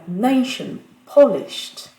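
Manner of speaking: "nation" and "polished", opening syllables punched loud; stress on first syllable. Shown as a demonstration. Teacher showing default pronunciation, "nation" and "polished" stressed on the first syllable. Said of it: "la prononciation par défaut".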